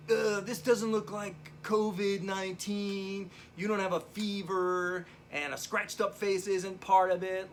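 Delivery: lightly put-on voice of dismissive staff; mock hospital staff. ER staff brushing off a covid patient, mock dismissive.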